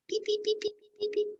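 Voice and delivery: very high, soft peeping; duckling peeps. Acted. Ducklings hatch from their eggs, soft high peeps. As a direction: peep like tiny ducklings.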